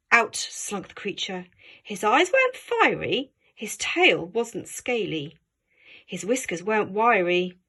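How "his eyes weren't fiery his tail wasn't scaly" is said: ordinary delivery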